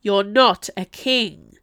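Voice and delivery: loud, slow, emphatic accusation; mad scream, held back. A narrator's reading. Restrained madman accusing the Duchessa, screaming slowly. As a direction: punch it out word by word, furious. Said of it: "the mad Ducomte screamed at the Duchessa".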